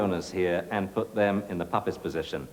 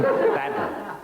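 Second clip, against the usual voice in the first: much higher, hammed-up bad dog; mock scolding. Trainer playfully scolding the human puppy, hammed up. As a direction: scold her in playful exaggeration.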